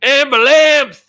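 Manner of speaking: loud, high, drawn-out cry for help; Vietnam Tom. Retold or imitated. Quoting a YouTube clip to friends, mock yell. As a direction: holler it like the man in the viral clip. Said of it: "Have you ever seen Vietnam Tom".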